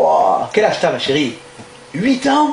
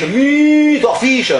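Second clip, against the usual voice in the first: spelling it out slowly; 5 semitones higher, much slower than usual. Acted. Owner slowly stretching Meat or fish? for the English tourist. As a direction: drag out Meat or fish? word by word.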